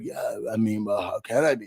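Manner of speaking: quiet, mumbling impression of him; lightly voiced sulky guy; mumbled mock Tucker. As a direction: mutter it like a sulky houseguest. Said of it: "He was like".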